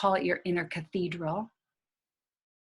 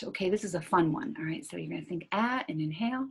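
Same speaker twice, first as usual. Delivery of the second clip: usual speaking voice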